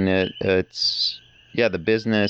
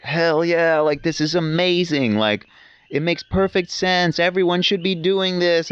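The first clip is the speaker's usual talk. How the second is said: mock excited fan; 9 semitones higher than usual. Imitating permaculture newcomers gushing, higher and excited. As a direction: ham up the newly converted enthusiast. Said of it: "they get all up in arms and super excited".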